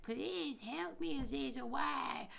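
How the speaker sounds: high, slow, wailing mock plea to a spirit; retold sobbing cry for help, drawn out high. Pleading with Azazel through tears, high and slow. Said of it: "so many times I'm crying".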